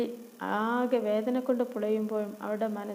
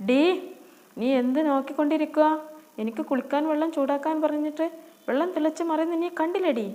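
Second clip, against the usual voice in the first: loud, higher shrill-free stepsister scolding; angry scolding; 5 semitones higher, 6 dB louder than usual. Acted. Sister yells at Noorin over the boiling water, angry. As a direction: yell at her for the boiling water. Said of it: "ദേഷ്യത്തോടെയുള്ള അവളുടെ മുഖം".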